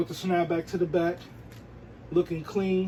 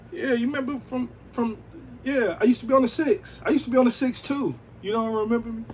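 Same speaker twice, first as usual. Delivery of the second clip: eager flirt; 6 semitones higher than usual. Pretend. Vlogger acts out flirting with J-Lo, eager and raised. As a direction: hype yourself up as the Bronx guy. Pretend pickup talk continues.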